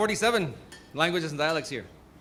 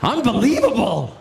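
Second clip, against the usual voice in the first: drawn-out, slower head-shaking unbelievable; milk the disbelief for the camera close-up; slow mock disbelief; slower than usual. Shown as a demonstration. Host redoing his reaction for the camera zoom, slow mock disbelief. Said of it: "I'll do it again".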